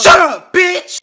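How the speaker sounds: loud, high barking yell; furious shout. Acted. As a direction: scream at the kid to shut up. Dad yells at kid.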